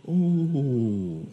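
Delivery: long, drawn-out falling "oh"; dawning realisation. Acted. Believer grasps an old vision through teaching, dawning realisation. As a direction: let the understanding slowly dawn. Acts out sudden understanding of vision.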